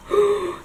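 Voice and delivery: mock gasp. Real, not acted. Reader catches herself spoiling plot.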